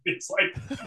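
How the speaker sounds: ordinary delivery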